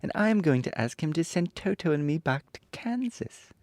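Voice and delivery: put-on high-pitched girl character voice; Dorothy. A narrator's reading. Dorothy telling friends her wish, high girlish voice. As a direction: lift well up for a little heroine. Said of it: "added Dorothy".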